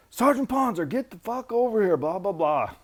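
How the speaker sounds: high, loud furious yell; staff sergeant yelling. Retold or imitated. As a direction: bark his name across the yard. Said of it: "Chews my ass".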